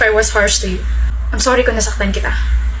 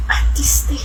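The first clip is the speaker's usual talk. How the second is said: taunting whisper; 4 semitones higher, 3 dB quieter than usual. Acted.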